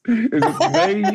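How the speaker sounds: mock-worried, laughing. Real, not acted. Joking about the room's smell.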